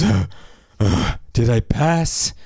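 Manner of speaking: slow, winded question between heavy breaths; panting. Acted. Exhausted trainee reaches the cabin and asks his teacher, panting. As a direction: gasp it out slowly, acting exhausted. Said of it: "he's panting".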